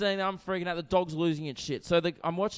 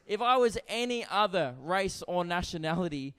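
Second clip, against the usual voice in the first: ordinary delivery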